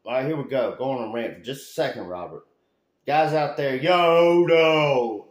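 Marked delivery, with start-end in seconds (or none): 3.8-5.3 loud, high yo-no shout. Real, not acted. Ranting at bad workers, shouting no. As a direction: cut them off with a loud no.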